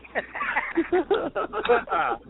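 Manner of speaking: high, drawn-out laugh; real burst of high-pitched laughter. Real, not acted. Storyteller cracking up at old footage, high laughter.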